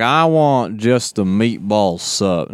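put-on slow, drawn-out Texan accent; big Texan drawl. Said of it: "He's like".

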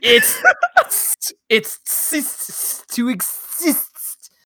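sputtering, spraying spit mid-word; words breaking up in a sputter; spit take. Real, not acted. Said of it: "I just spat all over the place".

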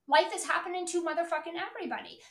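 normal talking voice

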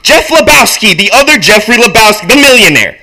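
high, loud, emphatic reply; acted heated announcement; shouted answer. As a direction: hammer out the answer. Walter answers at full volume.

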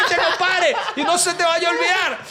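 high, eager voice of a local explaining; chirpy Caribbean Colombian local; coastal local man. Retold or imitated. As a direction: play the helpful village guy. Local man shouts advice.

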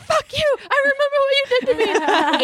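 very high squeaky angry dog voice; comic falsetto grudge yelled at an enemy; dog's mock tirade. Voicing the dog barking at gator.

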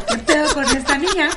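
laughing quote. Retold or imitated. Retelling the teachers' shock, laughing.

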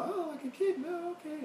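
put-on very high-pitched childlike voice; mock little kid. Playing himself as a gullible child.

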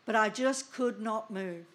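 usual speaking voice

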